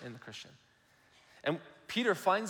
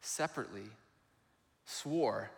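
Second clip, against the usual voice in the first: ordinary talk, nothing marked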